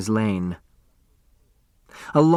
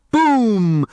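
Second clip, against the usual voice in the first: much higher, louder imitated boom; big exploding boom; loud firework boom; 10 semitones higher, 5 dB louder than usual. A narrator's reading. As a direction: blast it like a rocket bursting. Fireworks bursting.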